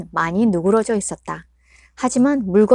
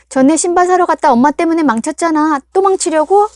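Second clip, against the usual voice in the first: put-on sharp, loud and high teenage retort; daughter snapping; 8 semitones higher, 8 dB louder than usual. Daughter snaps back at her mother, sharp loud retort. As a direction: throw the old grievance back at her.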